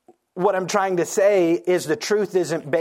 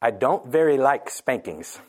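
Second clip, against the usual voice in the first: put-on low little-kid confession; 8 semitones lower than usual. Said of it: "She was very quiet".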